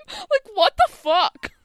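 high-pitched, drawn-out incredulity; baffled disbelief. Real, not acted. Co-host recapping an absurd dance scene, baffled.

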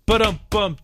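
high sung drum rimshot after a joke; rimshot sound. Pretend. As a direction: sing the rimshot, ba-dum-bum. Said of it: "Thank you. Here all week".

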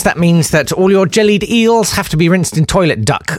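usual speaking voice

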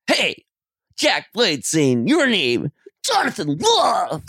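high, slow put-on rocker voice; slow, sung-out rock star greeting. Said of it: "read their name out as [name]".

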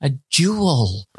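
imitating a condescending posh daughter; slow, stretched correction of dad; patronising child. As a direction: talk down to him, word by word. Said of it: "she literally is so patronising".

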